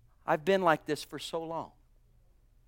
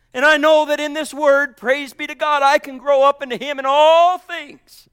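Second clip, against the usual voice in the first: climbing to a loud high cry; preaching crescendo; 6 semitones higher, 12 dB louder than usual. Acted. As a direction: shout the last words to the church.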